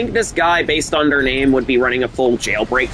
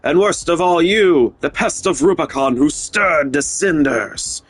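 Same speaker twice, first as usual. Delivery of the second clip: Snail impression. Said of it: "Pretty good snail impression?".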